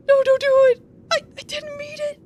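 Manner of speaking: high, loud begging; frightened plea. Acted. Marn begs Wiki not to touch him, high and afraid. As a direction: beg her to stop, scared.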